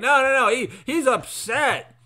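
hammed-up coach defending his player; pleading coach. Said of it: "the coach was like".

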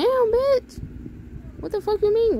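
very high-pitched incredulous squeal; offended disbelief. Real, not acted. Offended by a game message.

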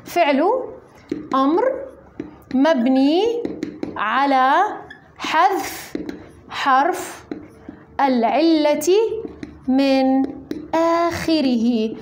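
slow word-by-word dictation. Shown as a demonstration. Teacher dictating the note to students, slow and spaced. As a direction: dictate each word with pauses to write. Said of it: "خلونا نكتب الملاحظة هون".

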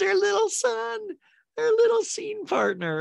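put-on very high baby-talk squeal; exaggerated, sky-high cutesy voice; mock cutesy cooing. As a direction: coo it as if about a tiny child. Cooing about a little son.

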